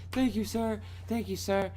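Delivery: high, small pleading voice; acted meek gratitude. Injured passenger thanking the cop, high and meek.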